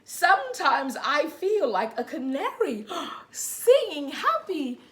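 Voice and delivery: put-on reading to children. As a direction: read gently to little ones. Reads picture book to children.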